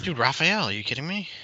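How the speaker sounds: high, disbelieving are you kidding; outraged disbelief; incredulous. Real, not acted. Outraged friend correcting.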